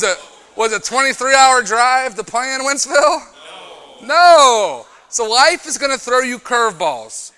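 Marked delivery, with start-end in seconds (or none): 2.6-4.9 Wentzville stretched very slow and high; slow, drawn-out. Acted. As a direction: drag out Wentzville like a punchline. Repeats the town for effect.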